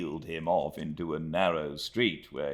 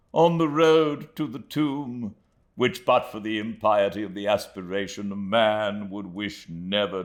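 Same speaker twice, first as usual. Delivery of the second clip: slow, louder, wistful oration; fondly lamenting Micawber; 5 semitones higher, 5 dB louder, slower than usual. A narrator's reading. Micawber to Copperfield and Traddles, slow and wistful. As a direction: linger over the bench fondly.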